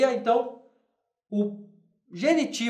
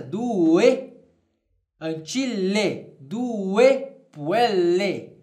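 very slow due puelle; slow Latin model; much slower than usual. Shown as a demonstration. Teacher modelling Latin ending change for students. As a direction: stretch each syllable for the class. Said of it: "trocaria a desinência".

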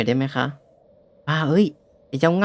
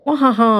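hammed-up laugh; 8 semitones higher than usual. Judge turned contestant gag.

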